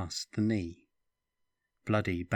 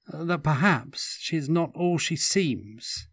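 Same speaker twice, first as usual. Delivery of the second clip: careful; 7 semitones higher, 5 dB louder than usual. A narrator's reading. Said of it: "Stefan said carefully".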